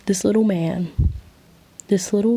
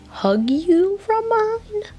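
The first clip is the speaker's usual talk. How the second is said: much higher, stretched-out words; giddy squeal; 13 semitones higher, slower than usual. A narrator's reading. Narrator excited about the hug from behind, squealing. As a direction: let it rise into a long high squeal. Squeals over her own story idea.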